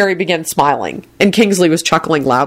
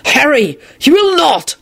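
sudden shout; 11 semitones higher than usual. A narrator's reading. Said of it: "Lupin shouted suddenly".

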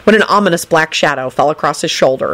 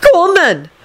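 high, impatient command; 12 semitones higher than usual. A narrator's reading. Neville shooing the Hufflepuff girls away, impatient. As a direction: hurry them along. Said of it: "told the group impatiently".

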